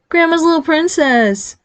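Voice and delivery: put-on baby voice. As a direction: coo it mockingly at your brother. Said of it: "Nick adopted a baby voice".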